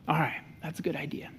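soft, quick approving dad; encouraging parent. Retold or imitated. Dad approving his daughter's plan, soft and warm. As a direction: lightly praise the kid's idea. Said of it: "A better approach might be something like this".

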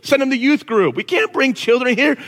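high, loud, whining objection; disciples' loud whiny protest. Retold or imitated. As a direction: play the disciples shooing the kids away, loudly. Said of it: "the disciples are like".

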